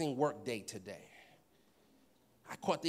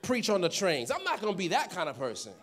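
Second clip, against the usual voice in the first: proud self, neck arched; 3 semitones higher, 8 dB louder than usual. Retold or imitated. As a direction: swear it with your neck arched up. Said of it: "arch the neck a little bit".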